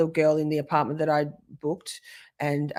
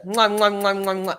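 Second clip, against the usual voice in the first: smacking air kisses; blowing kisses; 2 semitones higher than usual. Real, not acted. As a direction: blow kisses goodbye.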